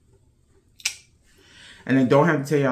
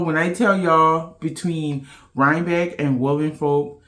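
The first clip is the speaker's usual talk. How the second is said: usual speaking voice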